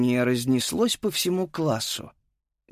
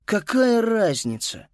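weary; 8 semitones higher, slower than usual. Acted. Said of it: "устало выглянула".

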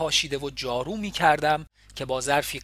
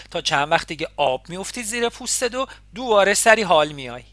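put-on nosy neighbour in a thick Isfahani accent, higher and louder; 3 semitones higher, 6 dB louder than usual. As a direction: welcome her in broad Isfahani, a bit scolding. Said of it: "با لهجهٔ غلیظ اصفهانی".

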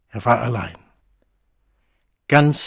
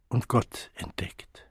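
geflüstert; 8 dB quieter, slower than usual. A narrator's reading. Narrator's hushed revelation.

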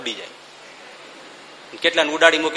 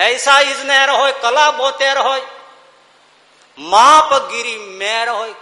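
declaimed verse; 7 semitones higher, 3 dB louder, slower than usual. Acted.

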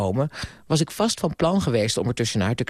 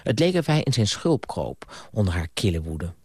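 ordinary delivery, nothing marked

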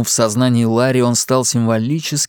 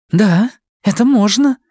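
higher, louder female voice, lit up with excitement; eager young woman; 10 semitones higher, 4 dB louder than usual. A narrator's reading. Young woman warms to the motorcycle plan, eager. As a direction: let her brighten at the idea.

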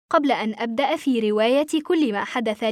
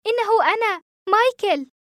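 high, insistent call; urgent raised voice; shouting over noise; 9 semitones higher, 4 dB louder than usual. A narrator's reading. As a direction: cry out your name over airport din. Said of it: "صرخت وسط ضوضاء المطار".

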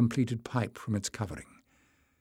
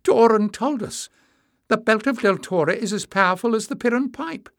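much higher, urgent protest; burst out; 11 semitones higher, 7 dB louder than usual. A narrator's reading. Emlys protests to her mother, much higher. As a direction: blurt it out in shock.

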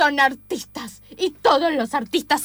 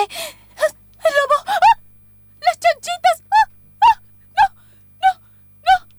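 terrified screams; 4 dB louder, slower than usual. Acted. Pig sees wolf on camera.

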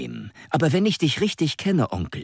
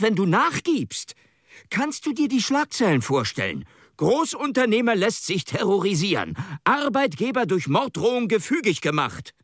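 indignant nephew; 9 semitones higher than usual. Acted. Nephew protests, voiced higher.

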